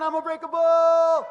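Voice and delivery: slow, high, very loud "I am unbreakable"; shouted affirmation. Shown as a demonstration. Speaker leads the crowd in yelling the affirmation, loud shout. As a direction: lead the crowd at full volume. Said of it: "Let's bring the roof down".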